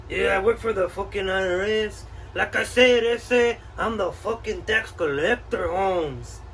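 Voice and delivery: put-on laid-back Chicano street accent, ese and vato. Collector repeats himself.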